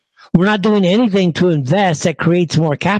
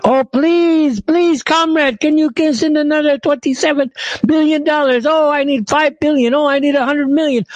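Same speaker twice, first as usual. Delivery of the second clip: high, whiny mock plea, much higher than his own voice; mock begging; 9 semitones higher than usual. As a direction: ham up a pleading beggar asking for money. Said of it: "begging people for money every other week".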